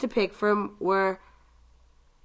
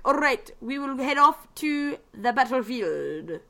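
slow, lightly put-on Irish Scottish accent; a hint of Irish Scottish lilt, drawn out; 3 semitones higher than usual. Imitating the game's Irish Scottish chick, lightly. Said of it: "the Irish Scottish chick".

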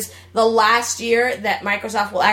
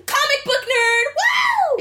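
high excited squeal; excited nerd. Retold or imitated. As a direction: shout it like a thrilled fan. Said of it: "it's like".

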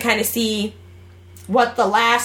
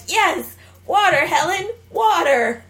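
high, slow teaching voice; teacher voice; 7 semitones higher, slower than usual. Retold or imitated. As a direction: drag out water like a patient teacher. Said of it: "She's like".

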